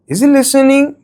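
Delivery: "Is he listening" climbing to a high pitch; rising question intonation. Shown as a demonstration. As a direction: ask it high, lifting "listening". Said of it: "rising intonation".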